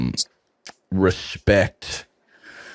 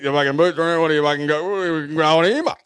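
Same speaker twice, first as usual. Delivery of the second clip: higher, rough-edged outback farmer bark; old cocky voice; 6 semitones higher, faster than usual. Retold or imitated. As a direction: play a baffled old farmer yelling at the pitch. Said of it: "an old cocky would have walked through that and gone".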